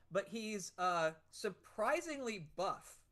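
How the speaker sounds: ordinary talk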